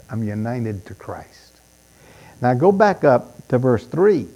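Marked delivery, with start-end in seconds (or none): 0.1-1.6 hushed. Shown as a demonstration. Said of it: "That's it".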